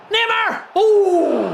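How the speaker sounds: high, loud whoop; excited shout. Real, not acted. Streamer reacting to Neymar in the match, excited shout. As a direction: cry out the player's name and soar. Reacting to play in football game.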